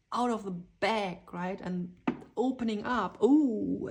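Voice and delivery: ordinary talk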